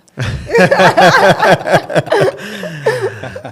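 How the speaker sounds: a long, loud, high laugh; high burst of laughter. Real, not acted. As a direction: laugh hard, amused and surprised. Laughs at unexpected question.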